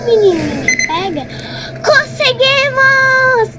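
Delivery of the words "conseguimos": high, slow triumphant cheer; excited. Real, not acted. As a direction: cheer the win. Opened the door.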